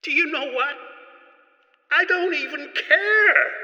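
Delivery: high-pitched, exasperated dismissal; theatrical high-voiced huff of not caring; defiant outburst. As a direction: pitch it way up, drawing out the last words. Boss dismisses failed takeover.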